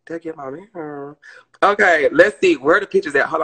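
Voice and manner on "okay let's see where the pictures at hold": loud. Real, not acted. Searching phone excitedly.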